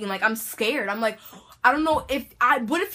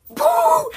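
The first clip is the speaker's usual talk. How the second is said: very high loud yelp; sudden shrieking yell; startled shout; 9 dB louder than usual. Retold or imitated. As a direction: burst out and flee. Sudden shout, then running.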